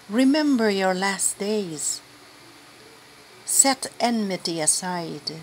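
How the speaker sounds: scripture proclamation. Shown as a demonstration. Lector proclaiming Sirach to the assembly, firm and stern.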